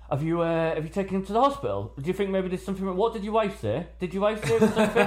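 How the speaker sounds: high, fast worried dad voice; imitating anxious dads. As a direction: fire off worried questions like a fussy forum dad. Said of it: "other dads will chip in".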